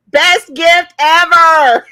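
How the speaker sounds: loud, high, slow and drawn out; elated exclamation. Real, not acted. Fan praising her birthday gift, loudly elated. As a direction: draw it out with sheer delight.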